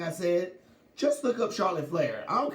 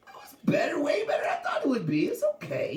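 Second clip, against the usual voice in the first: excited; 11 semitones higher than usual. Real, not acted. Excited about match.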